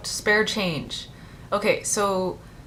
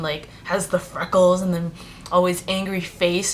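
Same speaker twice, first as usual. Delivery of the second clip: ordinary talk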